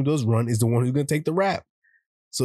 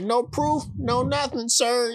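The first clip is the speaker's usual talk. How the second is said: high cheeky denial to a cop; sassy innocence; 10 semitones higher, slower than usual. Retold or imitated. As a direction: play innocent, smug and high. Boys sassing the cop.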